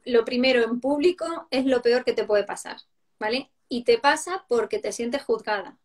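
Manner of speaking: usual speaking voice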